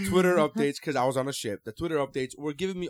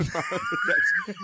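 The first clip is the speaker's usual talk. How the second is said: a sudden high laughing yelp; laughing outburst at a friend's voice; laughing shriek. Real, not acted.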